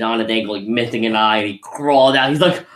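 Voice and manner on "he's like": ordinary delivery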